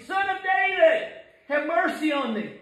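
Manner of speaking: very high, straining plea; crying out. Acted. Blind man begging Jesus to hear, high desperate cry. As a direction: call out desperately at the top of the range. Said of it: "cried the more".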